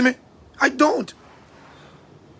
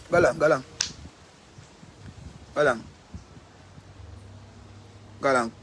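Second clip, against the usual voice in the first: dismissive shooing; 6 semitones lower, 9 dB quieter, faster than usual. Retold or imitated. Sending the boyfriend off, dismissive and fading. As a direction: lower and softer, fading away.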